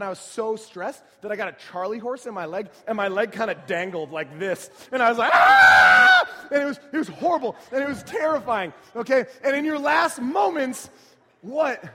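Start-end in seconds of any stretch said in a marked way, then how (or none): 5.3-6.5 retold scream. Retold cramp on roller coaster.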